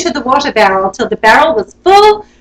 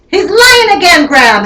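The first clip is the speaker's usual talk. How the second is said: howled; 6 semitones higher than usual. Acted. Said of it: "howled Ninny Nanny".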